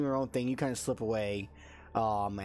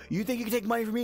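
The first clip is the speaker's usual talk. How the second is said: high-pitched menacing rant; angry cursing; 10 semitones higher, 3 dB louder, much faster than usual. Acted. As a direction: berate a debtor, voice jumping up. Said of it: "start cursing".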